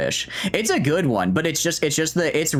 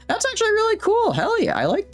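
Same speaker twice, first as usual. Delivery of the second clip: squeaky high. Real, not acted. Delighted reaction, squeaky repeat.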